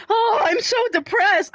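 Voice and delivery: high, slow, mock-depressed moan; mopey sigh.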